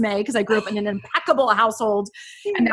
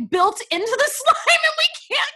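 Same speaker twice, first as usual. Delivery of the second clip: laughing disbelief. Real, not acted.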